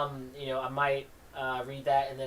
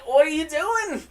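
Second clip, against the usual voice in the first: alarmed cry; 12 semitones higher, 7 dB louder than usual. Acted. Dude panics in comic.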